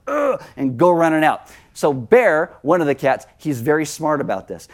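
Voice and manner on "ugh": a high little cat grunt. Pretend. Cat slipping through the slider, high grunt. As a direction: voice the cat bolting for the door. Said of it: "the cat will be like".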